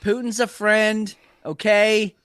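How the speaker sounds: hammed-up Trump bragging and reassuring; mock president promising a Mar-a-Lago weekend; Trump impression. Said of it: "this is President Trump".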